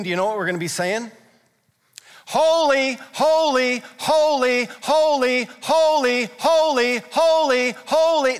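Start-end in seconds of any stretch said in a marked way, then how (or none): 2.3-8.4 loud, high, repeated acclamation; exultant worship cry. Acted. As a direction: cry it out over and over like heaven's worshippers. Acting out endless worship of heaven.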